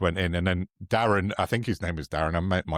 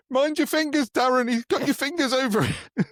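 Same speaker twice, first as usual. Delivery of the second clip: much higher squeaky bossy voice; squeaky mock order. Mocking Bridge ordering his mate to turn the camera, in a squeaky high voice. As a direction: boss Darren about in a silly squeak.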